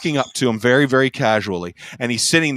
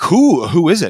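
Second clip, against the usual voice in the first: eager retold question, higher and louder; 5 semitones higher, 6 dB louder than usual. Drum shop guys demand to know who's next door, eager. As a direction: ask it like you can't wait to know.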